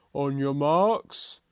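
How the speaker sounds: slow, loud call; tortoise starting a race. A narrator's reading. Tortoise readies for the race, calling slow and loud.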